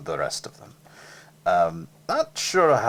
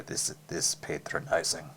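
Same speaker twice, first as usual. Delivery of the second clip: muttered aside; 4 semitones lower, 9 dB quieter than usual. Real, not acted. To the audience, a soft muttered aside. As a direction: lower and much softer aside. Aside after baby talk.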